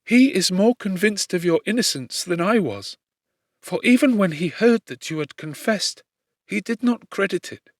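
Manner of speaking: a hint of a gentle noblewoman comforting; young lady, earnest. A narrator's reading. Elizabeth voiced in reading.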